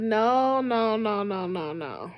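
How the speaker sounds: trailing down to almost nothing; fading refusal. Acted. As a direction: start near normal, fade low and soft. Exasperated refusal, dying away.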